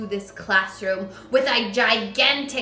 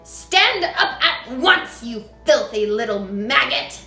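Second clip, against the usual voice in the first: loud, higher angry command; furious yell; 3 semitones higher, 10 dB louder than usual. A narrator's reading. Trunchbull yelling at Matilda. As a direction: spit the insult out.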